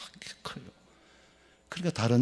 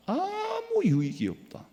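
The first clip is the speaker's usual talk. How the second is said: high drawn-out preaching line; slower than usual. Acted. Preacher drives point.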